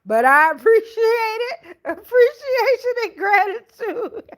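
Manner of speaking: high, loud, laughing mock cry; laughing wail. Real, not acted. Host told she looks fifty-seven, high laughing mock wail. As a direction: squeal it out through laughter, stretching the words. Laughing at viewer's guess of age.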